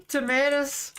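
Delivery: Tomatoes with a long American a, said laughing. Shown as a demonstration. Streamer laughing at the American way to say tomatoes. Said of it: "I can't stop saying tomatoes".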